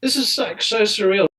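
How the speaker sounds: high-pitched retold amazement; starstruck disbelief. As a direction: relive a fan's awe at meeting his heroes. Starstruck fan reacting to meeting players.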